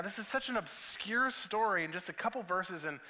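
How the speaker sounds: normal talking voice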